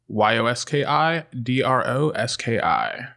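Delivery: ordinary talk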